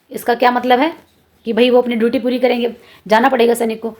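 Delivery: ordinary talk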